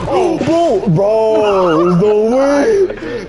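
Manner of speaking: high, loud, drawn-out panic; panicked shout. Real, not acted. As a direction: shout in disbelief at the killer. Spots the killer in game.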